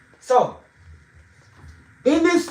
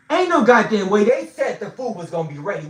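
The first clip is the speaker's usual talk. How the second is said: indignant retold outburst, loud at the start; angry protest; 3 semitones higher, 6 dB louder than usual. Hungry king protests to his wife about the late food, angry outburst. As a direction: burst out loud, then ease off.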